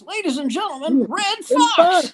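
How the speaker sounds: announcer brings him on again, bigger; announcer overplaying the introduction for an empty room; announcer call again. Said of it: "The guy goes".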